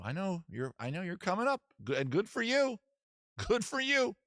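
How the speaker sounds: high, patronising sing-out; mock congratulation. Californian humouring rival Texas, mock congratulation.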